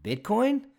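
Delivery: Bitcoin? thrown up sharply high and loud; sharp rising challenge on the name; pointed rhetorical question. Real, not acted. Rhetorical question set against Visa.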